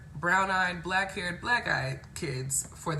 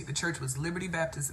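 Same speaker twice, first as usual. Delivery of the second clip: ordinary talk, nothing marked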